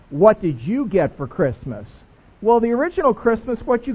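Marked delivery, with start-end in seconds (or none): 0.1-1.9 slow, measured pastoral question. Shown as a demonstration. Pastor redoing the question in proper preacher style. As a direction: ask it slowly like a preacher would. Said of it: "like a pastor".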